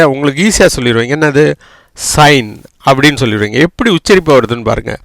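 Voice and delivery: usual speaking voice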